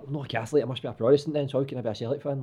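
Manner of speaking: put-on high, confused wee boy voice; childhood self. A confused wee boy asking his mum about religion. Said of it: "and I'm like".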